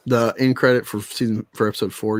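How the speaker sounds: usual speaking voice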